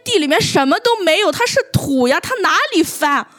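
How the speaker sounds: put-on a kid stubbornly arguing the facts; indignant pupil. Teacher imitating a pupil's indignant essay protest, high and loud.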